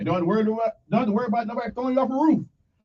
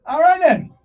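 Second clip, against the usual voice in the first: upbeat call; 7 semitones higher, 9 dB louder than usual. Real, not acted. DJ back on air after song.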